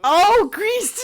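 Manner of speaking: high, loud startled yelp; startled shriek. Real, not acted. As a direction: jump out of your skin. Jump scare from the cat.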